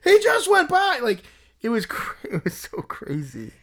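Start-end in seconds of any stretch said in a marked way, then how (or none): 0.0-1.0 much higher, loud, crying-out shock; stunned disbelief. Retold or imitated. Fan told the player just left, shocked yelling. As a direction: yelp it like the news just hit you.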